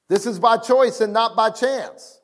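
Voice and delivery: normal talking voice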